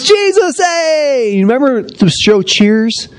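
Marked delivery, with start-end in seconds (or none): 0.0-1.3 high-pitched delighted welcome; cheerful crowd greeting. Pretend. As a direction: call out his name like old friends. Crowd greeting Jesus at reunion.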